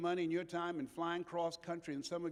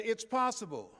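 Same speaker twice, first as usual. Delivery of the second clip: call to repeat; 5 semitones higher, 5 dB louder than usual. Shown as a demonstration. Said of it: "let us say together".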